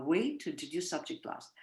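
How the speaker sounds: usual speaking voice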